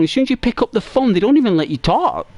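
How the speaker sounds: usual speaking voice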